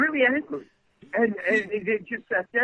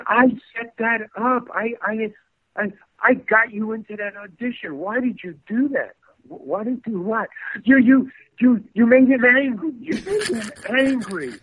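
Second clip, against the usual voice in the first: high-pitched, imitated panicky scolding; frantic reproach; 6 semitones higher than usual. Friend who set up the audition scolds actor, retold frantic reproach. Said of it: "frightened or crazy".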